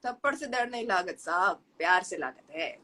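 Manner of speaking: fast, low Bhojpuri dialect. Acted. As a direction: rattle it off in Bhojpuri. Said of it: "Bhojpuri language में".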